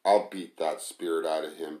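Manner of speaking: ordinary delivery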